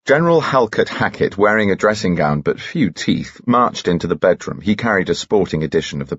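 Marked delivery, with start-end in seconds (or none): none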